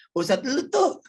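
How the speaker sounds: high jeering taunt; mocking relatives. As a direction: tease like a gossiping relative. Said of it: "Keluarga-keluarga suka pada ngomong gitu".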